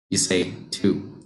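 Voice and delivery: normal talking voice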